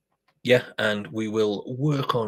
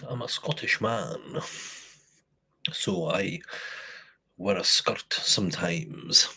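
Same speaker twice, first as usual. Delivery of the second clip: lightly put-on, unconvincing Scottish accent; a bad half-hearted Scottish accent, slow and softer; quoting a brewer in a weak Scottish accent; 5 dB quieter, slower than usual. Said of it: "in a really unconvincing Scottish accent".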